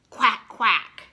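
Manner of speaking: loud put-on quack; duck quack. George at the vet, loud duck quack.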